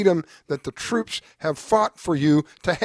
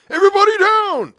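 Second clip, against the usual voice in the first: sudden high yelled command; police shout; 8 dB louder than usual. Pretend. Imagined raid cop yelling at a maskless family, shouting. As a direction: bark it like a cop kicking in the door.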